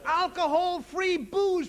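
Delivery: "Alcohol-free booze" drawn out slowly in disbelief; slow mock disbelief; slow incredulous. Shown as a demonstration. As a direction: spell it out slowly, scoffing. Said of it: "mocking product name".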